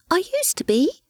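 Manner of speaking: high, tiny, wistful ghost voice; a sad little ghost mouse. A narrator's reading. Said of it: "it said with a shake of the head".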